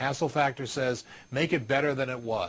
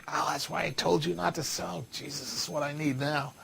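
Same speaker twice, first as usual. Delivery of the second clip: grumpy guest; 4 dB quieter than usual. Retold or imitated. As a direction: mutter like a put-out customer. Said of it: "People's moods go down and say".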